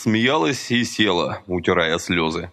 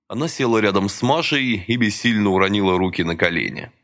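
ordinary delivery

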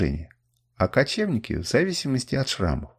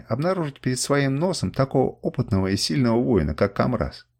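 usual speaking voice

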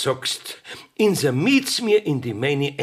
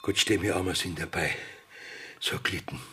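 much softer, lower whisper to himself; 8 semitones lower, 8 dB quieter than usual. Acted. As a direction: mutter it to yourself in dread. Richter murmelt zu sich selbst. (leise zu sich selbst geflüstert)

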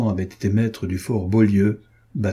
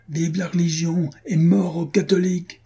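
cried war cry; 8 semitones higher than usual. A narrator's reading. Man on the rampart defies the enemy, high contained cry. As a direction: proclaim it on a raised pitch. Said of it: "cria-t-il".

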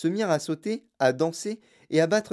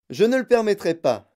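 high, loud refusal; shouting; 7 semitones higher, 6 dB louder than usual. A narrator's reading. Wonka forbids it, shouting. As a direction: cry it out firmly. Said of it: "cria Monsieur Wonka".

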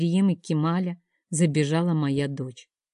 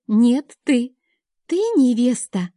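put-on high, louder child's protest; little girl; 6 semitones higher, 5 dB louder, slower than usual. A narrator's reading. Daughter insists her mother is the bride, high child voice. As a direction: insist like an excited small girl.

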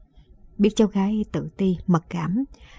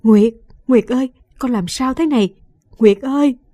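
louder, higher, calling out in panic; desperate cries of her name; frantic shout; 4 semitones higher, 5 dB louder than usual. Acted. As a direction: yell for the granddaughter who won't wake. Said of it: "Bà cuống cuồng hét lên".

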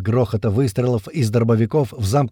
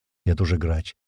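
ordinary delivery, nothing marked

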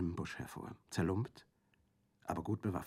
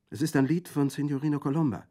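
put-on young girl; 7 semitones higher, 7 dB louder than usual. A narrator's reading. Girl answers Orso.

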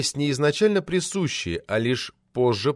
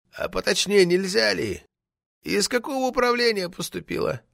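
high, lightly put-on old man's voice; bald little old man; 8 semitones higher than usual. A narrator's reading. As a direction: question like a prying old man. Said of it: "спросил лысый старичок Ардаматов". (старческий голосок)

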